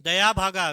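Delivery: दया भागा punched out loud, as the answer to remember; emphatic recall. Shown as a demonstration. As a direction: hammer दया भागा home as the exam answer. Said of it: "तो आपको याद होना चाहिए". (दया भागा पर ज़ोर, याद करवाने के लिए)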